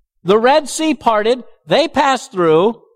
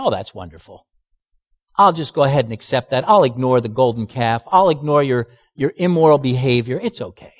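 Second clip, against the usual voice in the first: facetious mock God; 10 semitones lower, faster than usual. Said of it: "I'm being quite facetious".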